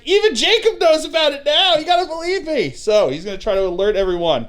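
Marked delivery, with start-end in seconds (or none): 0.1-2.7 higher, pleading insistence; pleading soldier. Retold or imitated. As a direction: beg them to believe you. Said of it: "He's like".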